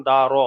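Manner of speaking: heavy, round-mouthed "র" after দা; full "র". Shown as a demonstration. Teacher showing a full letter, "র" heavy and round. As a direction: say "র" as raw, lips rounded. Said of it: "এটা হলো পুর".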